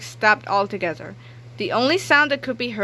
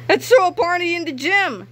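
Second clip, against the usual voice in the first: gleeful shout; 9 semitones higher, 4 dB louder than usual. Acted. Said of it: "shouted Miss Demeanor".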